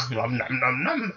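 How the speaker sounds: lightly voiced munching; munching noises. Eating watermelons.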